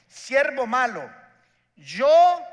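slow, loud rebuke that rises to a high shout; a king roaring at his wicked servant; angry king. Acted. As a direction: thunder at the servant like a furious king.

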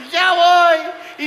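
high, loud, strained with a nervous laugh; father pinned to the wall, answering his kids in mock terror; scared reply. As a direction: call back while frozen with fear.

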